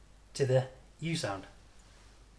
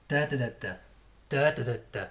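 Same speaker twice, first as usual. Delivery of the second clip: "Da, da, da, da" with first "Da" strong, rest light; strong "Da", quick weak "da, da, da"; rhythm syllables; faster than usual. Shown as a demonstration. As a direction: tap out the stress pattern on "da".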